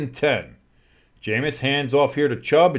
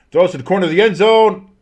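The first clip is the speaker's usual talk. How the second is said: quick, loud, high play-by-play; excited play call; 6 semitones higher, 7 dB louder, faster than usual. Real, not acted. Announcer calling the two-point throw, excited quick call. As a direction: raise it urgently as the ball flies.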